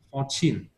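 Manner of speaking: "Fourteen" with the second syllable stressed; stress on -TEEN. Shown as a demonstration. Teacher asking student to reread, stressed ending on "Fourteen". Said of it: "mình đọc nhấn mạnh chữ âm chin".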